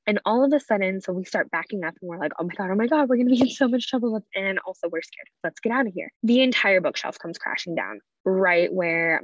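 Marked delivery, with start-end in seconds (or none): 2.4-4.2 mild mock panic, rising higher; retold panic. Kids backing away from shaking shelf, mild retold panic. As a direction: recount a rush of alarm, pitch climbing.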